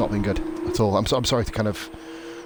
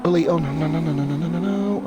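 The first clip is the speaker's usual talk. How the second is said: higher, urgent string of no's as a crash happens; dismayed no's; 8 semitones higher than usual. Real, not acted. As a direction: watch the wreck coming and plead. Crash unfolding on track.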